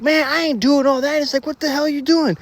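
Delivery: high, rattled protest; spooked friend. Retold or imitated. Frightened friend protesting to narrator, high and rattled.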